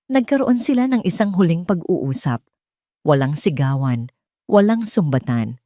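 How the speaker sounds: ordinary delivery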